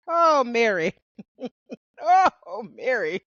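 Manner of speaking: hammed-up moan at the singer; mock wail. Real, not acted.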